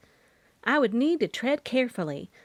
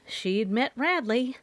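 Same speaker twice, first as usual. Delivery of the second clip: ordinary delivery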